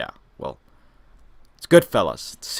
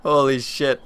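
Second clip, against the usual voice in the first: shocked exclamation; 4 semitones higher, 6 dB louder than usual. Real, not acted. Reacting to the film's twist.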